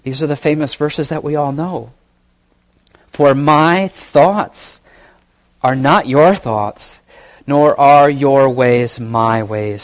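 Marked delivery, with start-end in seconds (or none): 3.1-9.8 slow solemn reading. Acted. Reads famous verse solemnly.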